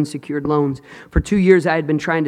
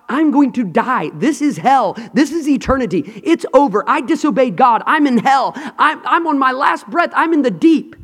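urgent, higher-pitched preacher's acting of despair; preached panic of a drowning prophet; desperate dying Jonah; 7 semitones higher, 4 dB louder than usual. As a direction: cry out as if on your last breath. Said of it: "He thought".

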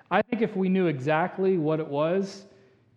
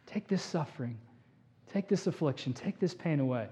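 hushed pleading; 9 dB quieter than usual. Acted. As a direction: plead quietly, almost under the breath. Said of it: "had begged, had pleaded".

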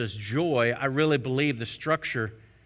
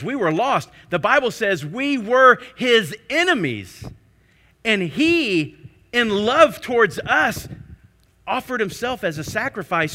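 full-force preaching; 6 semitones higher, 7 dB louder than usual. Acted. Pastor proclaiming Christ's sacrifice to the congregation, preaching hard. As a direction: let it ring out over the church. Preaching climax about Jesus' love.

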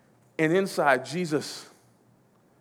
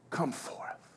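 soft, whispered call; breath-only, quiet calling forth; hushed summons; 2 semitones lower, 10 dB quieter than usual. Acted. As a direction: whisper the command as if to one listener. Jesus' call, first given hushed.

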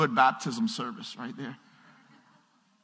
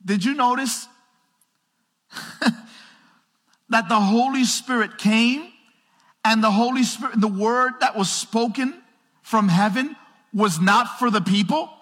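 loud, much higher preaching voice; full-force preaching; 10 semitones higher, 8 dB louder than usual. Acted. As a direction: drive the point home to the congregation.

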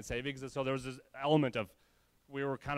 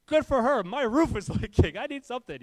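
louder and higher, with a laugh breaking in; exasperated outburst; 10 semitones higher, 11 dB louder than usual. Retold or imitated. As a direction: blurt it out, fed up but laughing. Exasperated reply about vacationing adjuster.

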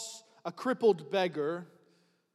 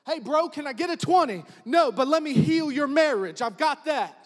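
loud playful banter; 6 semitones higher, 9 dB louder than usual. Acted. Playful modern retelling, shouted.

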